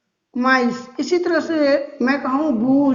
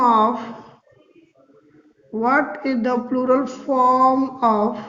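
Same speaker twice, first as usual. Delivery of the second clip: slow dictation; much slower than usual. Shown as a demonstration. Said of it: "teacher dictating the question".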